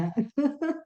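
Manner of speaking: short high chuckle; real giggle at the muddle; amused laugh. Real, not acted. Host watching the screen share go back and forth, amused chuckle.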